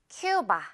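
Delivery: "큐바" said KYOO-ba for repeating; model pronunciation. Shown as a demonstration. Teacher has learners listen and repeat, English-style "큐바" as KYOO-ba. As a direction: say "큐바" clearly with "kyoo" first. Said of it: "한 번 더".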